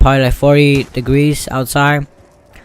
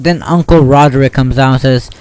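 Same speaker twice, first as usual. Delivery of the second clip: usual speaking voice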